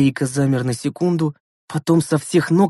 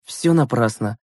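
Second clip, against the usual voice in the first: plain reading voice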